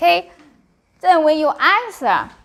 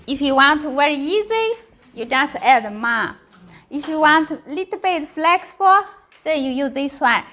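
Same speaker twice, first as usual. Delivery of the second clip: usual speaking voice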